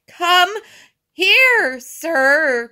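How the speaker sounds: loud, broken-up shout between breaths; winded, halting command; panting. A narrator's reading. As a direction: call out high, word by word, out of breath. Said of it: "panted Mister Grumby".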